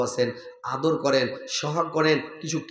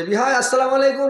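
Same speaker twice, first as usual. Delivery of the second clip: higher, louder greeting call; hearty greeting; 8 semitones higher, 5 dB louder than usual. Retold or imitated. Visiting in-law greeting loudly at the door. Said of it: "তো কইছে".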